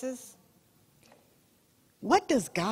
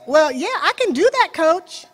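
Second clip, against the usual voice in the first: captain's cocky reply; 5 semitones higher, 5 dB louder, faster than usual. Retold or imitated. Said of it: "he says".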